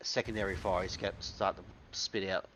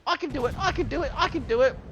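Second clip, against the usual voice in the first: put-on much higher, fast, excited kid's chant; eager little kid; 7 dB louder, faster than usual. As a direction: bounce around like a small kid begging to join in. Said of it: "the little kid that's bouncing around the big kids going".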